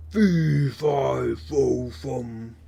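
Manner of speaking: loud start fading by fum; a thundering giant chant that trails off. A narrator's reading. Giant smelling Jack again, a thundering chant. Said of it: "he thundered".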